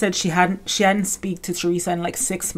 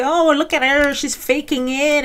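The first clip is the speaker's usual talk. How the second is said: high, snide mock sneer; mimicking online critics who call Melissa fake, snide; 8 semitones higher than usual. Mocking critics' voice.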